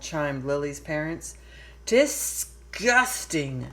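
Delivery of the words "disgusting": disgusted drawl. Acted. Lily sneers at the baby.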